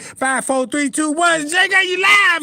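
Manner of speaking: countdown building to a loud high shout; mock TV host shouting that the stream is live. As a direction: ham up a showbiz countdown, rising to a yell.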